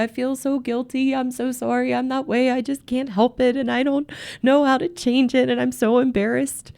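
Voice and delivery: high-pitched, put-on weepy apology; mock emotional wife. Wife playing martyr after being called out, mock emotional. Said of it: "she'll get very emotional".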